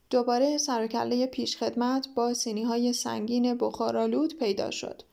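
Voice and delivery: ordinary delivery, nothing marked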